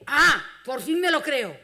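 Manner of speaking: loud, slow, teasing mock relief; playful "finally I believe it". Preacher teases the member who finally keeps coming, loud and slow. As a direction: draw it out loud, half-laughing, as if finally convinced. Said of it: "cuando la vea diré".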